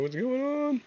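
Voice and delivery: high voice cracking on the words. Real, not acted. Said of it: "hear my voice cracking".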